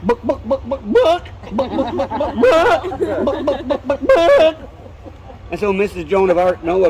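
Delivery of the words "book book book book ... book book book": higher clucking book book; playful chicken clucks. Acted. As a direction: cluck it like a hen. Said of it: "what chicken said".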